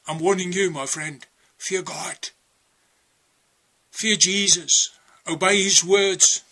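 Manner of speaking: firm preacher's warning to the flock; preaching. Acted. Preacher warning his listeners about hell, firm preaching. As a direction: drive each warning home like a preacher.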